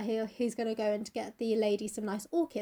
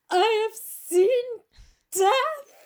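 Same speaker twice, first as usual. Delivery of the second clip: high, loud, slow mock-haunted cry; melodramatic dread; 12 semitones higher, 8 dB louder, much slower than usual. Dorian revived from his faint, melodramatic terror. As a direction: draw it out with hammed-up horror.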